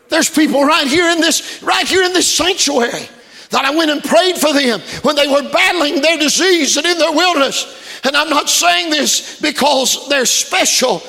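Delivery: high, loud impassioned preacher delivery; full-force preaching. Acted. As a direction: preach it hard, building to a shouted climax.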